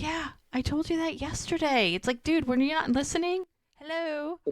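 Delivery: high-pitched, put-out retort; mock exasperation. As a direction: imitate someone fed up with not being heard. Retold exasperation at not listening.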